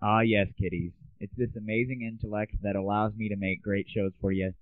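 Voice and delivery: normal talking voice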